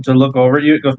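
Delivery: ordinary talk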